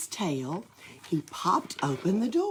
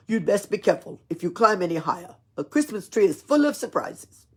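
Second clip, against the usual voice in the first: put-on fast, louder Russian-accented caution; Russian accent warning; 3 semitones higher, 7 dB louder, faster than usual. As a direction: warn him kindly in a Russian accent. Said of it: "he spoke in a Russian accent".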